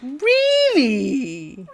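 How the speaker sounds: acted, overjoyed disbelief; exclaimed delight. A narrator's reading. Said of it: "Harry exclaimed delight-, delightedly".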